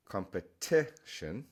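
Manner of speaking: competition drawn out, leaning on com-pe-TI-tion; stress on TI. Shown as a demonstration. Teacher shows English stress not to copy, stress on TI. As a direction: slowly hit the TI in competition. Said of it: "So you don't say".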